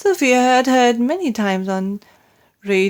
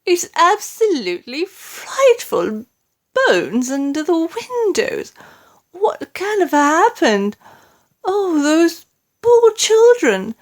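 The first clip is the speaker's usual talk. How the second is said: horrified queen; 9 semitones higher, 4 dB louder than usual. A narrator's reading.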